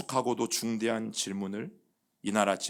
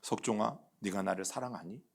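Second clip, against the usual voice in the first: soft, lower and hushed, tender asking; warm voice; 3 semitones lower, 8 dB quieter than usual. Retold or imitated. The Lord visiting the pastor at dawn, warm gentle question. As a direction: call him by name with gentle love. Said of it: "따스한 음성으로 그렇게 질문하셨어요".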